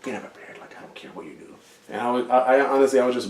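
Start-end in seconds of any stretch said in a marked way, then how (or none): 0.0-1.5 dismissive whisper. Retold or imitated. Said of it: "looking at me like".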